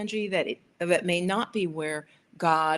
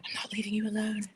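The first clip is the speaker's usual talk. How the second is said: breathy whispered pet voice, fast; voicing the dog; 4 semitones higher, 4 dB quieter, faster than usual. Voicing her clingy dog lying on her leg, quick whisper. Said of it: "the dog is like".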